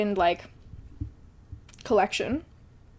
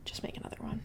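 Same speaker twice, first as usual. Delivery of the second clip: muttered to self; 17 dB quieter, faster than usual. Retold or imitated. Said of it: "I was like".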